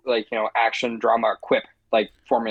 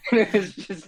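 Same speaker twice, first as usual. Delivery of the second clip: laughing through the words; chuckling as it comes out; 8 semitones higher, slower than usual. Real, not acted. Laughing at a joke.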